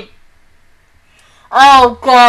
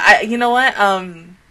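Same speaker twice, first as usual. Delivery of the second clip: ordinary talk, nothing marked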